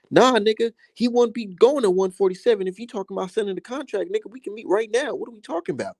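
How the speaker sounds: high, heated callout of the coach; fired-up high-pitched rant. Real, not acted. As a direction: call out the coach's excuses, pitch way up.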